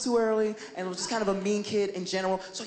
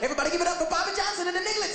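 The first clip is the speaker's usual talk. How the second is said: mock emcee intro; 10 semitones higher, much faster than usual.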